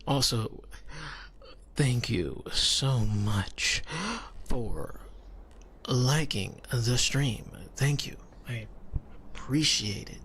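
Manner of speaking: slow, half-whispered thank-you; hushed, drawn-out ASMR gratitude with long gaps. Real, not acted. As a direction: savor each word softly in a low ASMR murmur.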